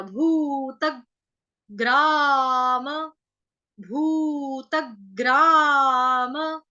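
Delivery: भूतग्राम stretched very slowly, half ग clearly heard; slow drawn-out drill. Shown as a demonstration. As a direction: drag भूतग्राम out, each sound separate. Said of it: "आधा ग आ जाएगा". (भूतग्राम को बहुत धीरे, आधा ग साफ़ सुनाते हुए)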